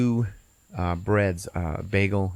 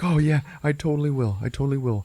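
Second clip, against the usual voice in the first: higher, eager promise; mildly over-eager assurance; agreeable stoner; 6 semitones higher than usual. Retold or imitated. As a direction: agree in a lightly voiced stoner's tone. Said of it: "And he's like".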